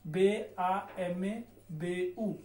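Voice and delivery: very slow, letter by letter; teacher spells the word slowly; spelling out letters. Shown as a demonstration. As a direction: spell बे आ एम ए बे ऊ with a pause after each letter. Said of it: "मेरे पास शब्द है bamboo".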